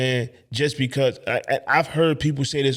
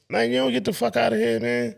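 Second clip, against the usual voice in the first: much higher, mock-incredulous; 10 semitones higher than usual. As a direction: sound floored by the small amount. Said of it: "But I'm like".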